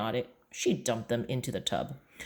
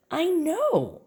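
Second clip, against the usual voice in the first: put-on child's voice. Boy voiced answering mom.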